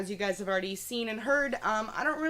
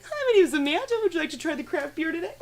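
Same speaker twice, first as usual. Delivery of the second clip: high bubbly server chirp; perky put-on greeting; Barbie voice; 6 semitones higher, faster than usual. Server offering craft beer, high Barbie chirp. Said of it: "Barbie voice".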